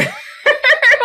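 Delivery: laughter breaking through the quote; loud high laugh. Real, not acted. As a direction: burst out laughing mid-sentence. Laughing at the dean's reply.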